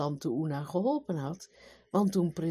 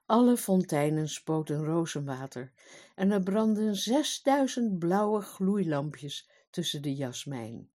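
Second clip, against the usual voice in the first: ordinary delivery, nothing marked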